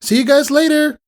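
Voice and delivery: high, loud call back to friends. Acted. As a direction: shout after friends leaving. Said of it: "Scootaloo called back".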